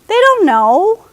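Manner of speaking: ordinary talk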